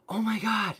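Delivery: high, breathless "oh my God" from a fan; excited fan gasp. Retold or imitated. Fan spotting him in the Apple store, excited gasp. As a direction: gush like a starstruck fan.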